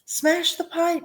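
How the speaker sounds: high, urgent yell; mother yelling. A narrator's reading. Mother yelling to break the pipe. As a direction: yell the order in panic. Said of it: "yelled Missus Gloop".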